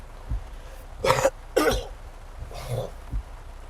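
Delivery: harsh hacking cough; coughing fit. Real, not acted. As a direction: cough hard after swallowing. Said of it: "Excuse me".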